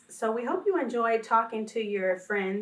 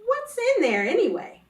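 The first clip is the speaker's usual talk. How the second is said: put-on higher, louder, skeptical child; nose-wrinkling question; 9 semitones higher, 6 dB louder than usual. Friend Nadia to the new girl about her lunch, skeptical and louder. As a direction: make it sound like the lunch smells weird. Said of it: "wrinkle her nose and say".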